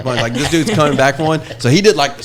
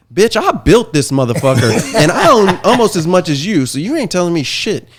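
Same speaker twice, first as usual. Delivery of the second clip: louder, heated snap back at a partner; retold angry comeback; 3 semitones higher, 7 dB louder than usual. Bar owner retelling how he told off his partner, heated. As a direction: throw it back in his face, half laughing.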